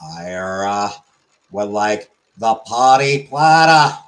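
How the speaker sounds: slow, deliberate Boston accent. Shown as a demonstration. Streamer practising an order of party platter, slow Boston accent. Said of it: "the Boston accent".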